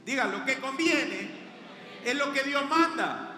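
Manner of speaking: insistent proclamation with punched key words; full-force preaching. Real, not acted. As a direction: preach it hard to the whole congregation.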